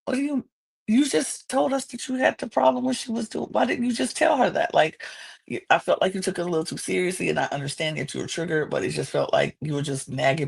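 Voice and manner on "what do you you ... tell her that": baffled reaction. Retold or imitated. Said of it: "And I'm like".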